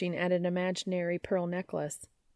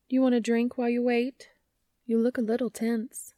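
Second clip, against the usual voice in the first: sweetly innocent, a bit higher; innocently teasing; 5 semitones higher, 4 dB louder than usual. Acted. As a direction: play innocent while needling him. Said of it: "she adds innocently".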